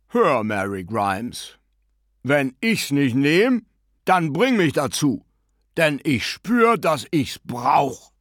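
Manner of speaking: put-on slow, drawn-out broad Dublin accent. A narrator's reading. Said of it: "sehr breiten Dubliner Akzent angenommen".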